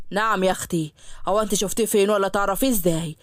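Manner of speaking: low voice, teasing suspicion; the father raising an eyebrow; suspicious father. Acted. Father questions daughter suspiciously.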